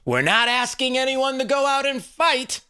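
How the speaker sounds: mock rally shout; imitating rally speaker. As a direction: echo the crowd speech like a rally leader. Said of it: "He said".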